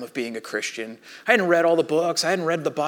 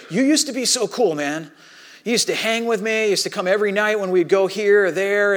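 higher, louder retold complaint; yelling friend; 5 semitones higher, 5 dB louder than usual. Surfer accusing his changed friend, higher retold yelling. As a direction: imitate a hurt, angry friend laying into you. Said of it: "starts yelling at me".

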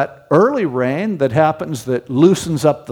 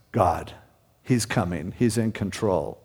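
much softer and lower, hushed certainty; soft reassurance; 7 semitones lower, 8 dB quieter than usual. Acted. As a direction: drop to a near hush of comfort. Quiet reassurance ending the quote.

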